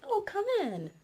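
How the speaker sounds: high, sing-song fake welcome; mock welcoming. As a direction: pretend to gladly invite the intruders in. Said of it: "and say, like".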